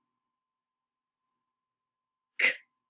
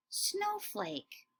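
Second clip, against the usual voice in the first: softer, higher snowflake; soft high echo; 9 semitones higher, 10 dB quieter than usual. Shown as a demonstration. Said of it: "say it right before me".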